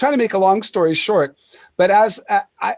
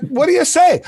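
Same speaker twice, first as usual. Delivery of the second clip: startled; 10 semitones higher, 4 dB louder than usual. Retold or imitated.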